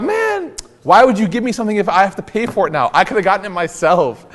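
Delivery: high-pitched, whiny "man" complaint; mock exasperation. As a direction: act out being put out by a bad gift. Acts out an annoyed reaction.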